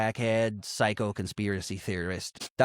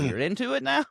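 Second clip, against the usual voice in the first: incredulous mock question; 12 semitones higher than usual. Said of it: "I'm like".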